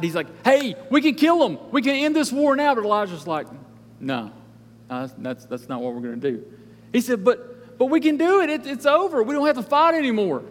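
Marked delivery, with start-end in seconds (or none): 0.4-2.7 higher, louder eager burst; excited king. Acted. King urging Elisha to end the war, excited. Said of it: "he's all excited". 4.0-6.4 low, soft, measured no; calm refusal. Acted. Elisha refusing the excited king, calm and quiet. 7.2-10.4 high-pitched eager protest; excited pleading. Acted. King pressing Elisha to finish the war, excited. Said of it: "he's all excited".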